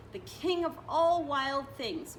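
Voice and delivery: ordinary delivery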